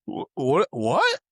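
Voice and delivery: retold high-pitched bewilderment; mock-alarmed, rising high; baffled. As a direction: act out being utterly confused, voice climbing. Acts out baffled reaction to text.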